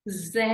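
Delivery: "Z" as French "zed" with a long open eh; stressed A sound. Shown as a demonstration. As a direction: lean on the vowel of "Z": "zehd". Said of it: "it's an accent grave".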